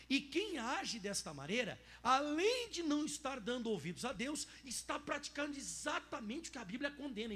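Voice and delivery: slow, loud emphatic reading; preaching read. Acted. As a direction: read it as a warning. Reads the text at full force. (leitura forte e lenta, em tom de aviso)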